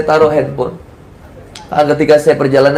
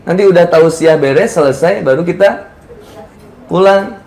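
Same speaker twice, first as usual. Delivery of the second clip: usual speaking voice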